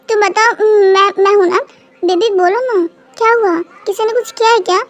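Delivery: normal talking voice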